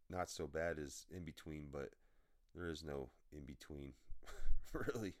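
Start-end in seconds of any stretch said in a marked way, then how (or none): 4.0-4.7 quick burst of laughter; short laugh. Real, not acted. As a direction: let one laugh pop out. Laughs at own remark.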